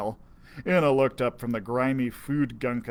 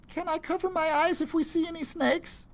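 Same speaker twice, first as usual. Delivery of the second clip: put-on squeamish girl. Enna afraid of snakes.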